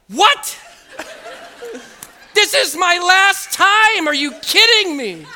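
high, loud mock outburst; outraged disbelief. Patient hearing of clot on last visit, outraged. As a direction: yell it in slow, high indignation.

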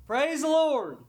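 high sing-out of praise; old saint's morning praise cry; praising old woman. Acted. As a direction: call it out high like a devout old lady. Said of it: "this little old saint of God".

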